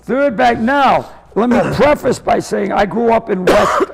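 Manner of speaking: ordinary talk, nothing marked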